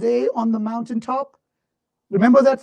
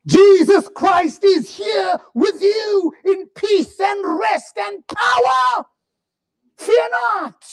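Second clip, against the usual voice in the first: full-force sermon climax; preaching at full force; 7 semitones higher, slower than usual. Acted. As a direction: thunder the promise over the crowd.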